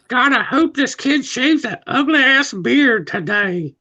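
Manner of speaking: put-on high, slow old ghost; old man voice. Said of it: "And he's like".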